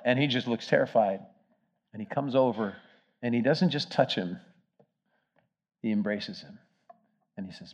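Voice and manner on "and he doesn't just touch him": normal talking voice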